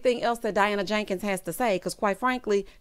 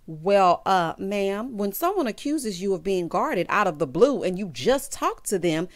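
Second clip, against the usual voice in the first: usual speaking voice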